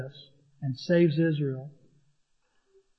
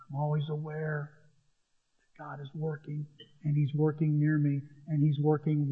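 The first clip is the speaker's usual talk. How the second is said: much softer, intimate musing; quiet reflection; 3 semitones lower, 9 dB quieter than usual. Shown as a demonstration. Pastor musing on David's words, soft and intimate. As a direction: pull it in close and soft.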